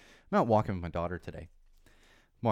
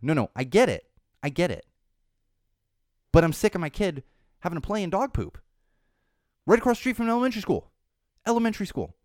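higher and louder, heated retelling of his complaint; retold indignant rant; 8 semitones higher, 6 dB louder than usual. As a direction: fire it back at her, fed up. Retold confrontation with dog walker.

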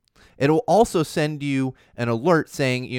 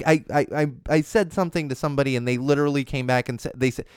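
ordinary talk

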